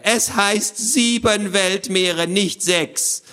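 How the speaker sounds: high, slow pedantic creature; squawky know-it-all. Acted. Voice from the box explains to children, pedantic squawk. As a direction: spell out the correction in a squawk. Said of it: "erklärte die Stimme".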